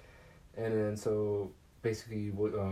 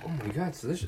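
put-on gushing girl; 7 semitones higher, faster than usual. Violet tasting the gum.

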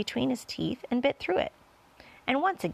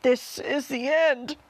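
loud, drawn-out cry of doom; despairing old grasshopper; 5 semitones higher, 5 dB louder, slower than usual. A narrator's reading. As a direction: cry out slowly, all hope lost. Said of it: "cried the old green grasshopper".

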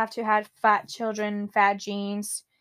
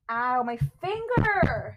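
slow, drawn-out cry of pain; stretched "ow" with a whimper; pained whine; slower than usual. Real, not acted. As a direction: whine over a hurt finger. Hurts finger while cleaning.

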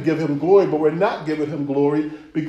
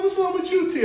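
much higher, baffled outburst; startled; 4 dB quieter, faster than usual. Acted. Asking T why he changed, much higher and baffled. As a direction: shoot it up in disbelief. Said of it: "I'm like".

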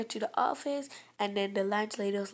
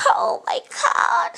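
much higher, loud, slow hammed-up wail; fake crying; 12 dB louder, slower than usual. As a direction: overact a fake cry. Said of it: "This is how she was like".

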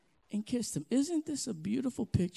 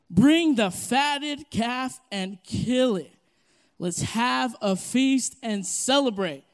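louder, slow commanding proclamation; loud preaching read of the father's commands; 3 semitones higher, 7 dB louder than usual. Acted. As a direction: boom the father's orders across the room.

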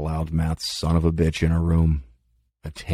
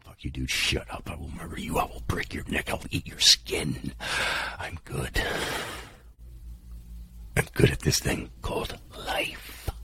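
hushed threat; 2 semitones lower, 9 dB quieter than usual. Retold or imitated. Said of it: "I'm like".

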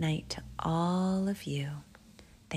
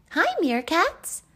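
very high, loud little Albie; eager greeting; 13 semitones higher, 8 dB louder than usual. Acted. Albie hailing the cheetahs, high eager call. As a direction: call out brightly as an excited child.

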